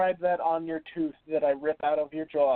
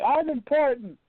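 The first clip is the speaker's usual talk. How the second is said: quoting him, higher; high mocking quote; 7 semitones higher than usual. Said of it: "as he keeps saying".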